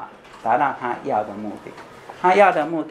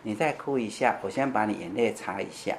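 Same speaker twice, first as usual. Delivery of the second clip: soft, tender soothing voice; softly comforting a crying child while wiping tears; gentle comfort; 4 semitones lower, 8 dB quieter than usual. Retold or imitated. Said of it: "我说".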